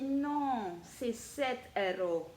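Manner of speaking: slow, drawn-out mock Italian accent; imitating a thrift-shop cashier's strong Italian accent. Leonardo at the till quoting the price, mock Italian accent. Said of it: "il a un fort accent italien".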